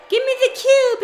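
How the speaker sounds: mock villain line. Narrating the movie, voicing the villain in a high voice. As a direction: play the villain's demand. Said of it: "And he's like".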